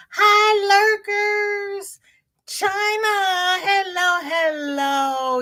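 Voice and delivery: greeting her lurkers with a sing-song hello. Real, not acted. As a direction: sing hello across the room. Greeting the chat viewers.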